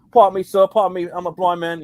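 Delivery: mock English accent, playing a blind beggar; Costner's English accent. Costner as blind man, hammed English accent. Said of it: "you see him doing a couple".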